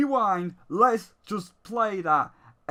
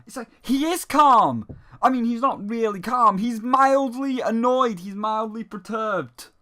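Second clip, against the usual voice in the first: usual speaking voice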